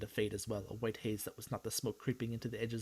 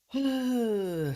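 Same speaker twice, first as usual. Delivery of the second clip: loud high sigh; 12 semitones higher, 9 dB louder than usual. Real, not acted. Stressed about money.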